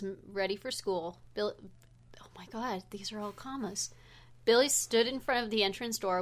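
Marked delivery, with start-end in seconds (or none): 2.2-3.9 whispered. Real, not acted. Shocked aside about commas.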